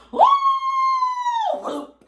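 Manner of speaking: long, very high, loud whoop; soaring "woo". Acted. Storyteller imitating the flame's high leap, soaring whoop. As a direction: whoop like something flying through the air.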